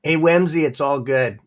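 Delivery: ordinary talk, nothing marked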